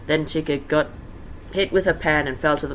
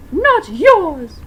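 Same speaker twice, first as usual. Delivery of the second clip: much higher, loud possessive snarl; angry Vixie; 10 dB louder than usual. Acted. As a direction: claim him furiously. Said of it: "said Vixie angrily".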